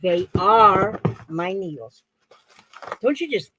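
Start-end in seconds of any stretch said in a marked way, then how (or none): 0.0-2.0 slow, with ARE stretched out loud; emphatic confirmation. Real, not acted. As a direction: lean hard on "are". Confirms what she had wondered.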